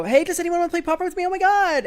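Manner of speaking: high, fast, gushing hello to the room; squealing mock enthusiasm; mock excited greeting. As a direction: play the eager newcomer begging for a game. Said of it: "and you go".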